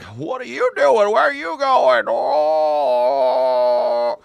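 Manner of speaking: put-on high-pitched, befuddled old man; hammed-up elderly man voice; ancient Uber driver. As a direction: play a very old driver lost and confused. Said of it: "guy driving is eighty-five years old".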